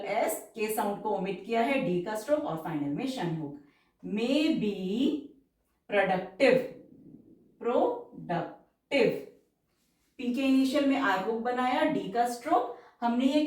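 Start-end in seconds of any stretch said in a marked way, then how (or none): 4.0-9.1 very slow, drawn-out dictation pace; stenography dictation, stretched syllables. Shown as a demonstration. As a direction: read it out word by word for shorthand.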